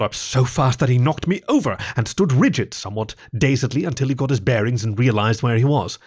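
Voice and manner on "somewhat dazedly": usual speaking voice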